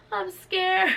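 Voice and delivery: high, loud mock-frightened reply; scared. Patient answering nurses before surgery, scared. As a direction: imitate a frightened patient calling back.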